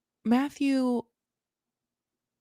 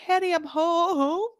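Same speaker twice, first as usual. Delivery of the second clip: sitcom honey I'm home; 8 semitones higher than usual. Pretend. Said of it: "He's like".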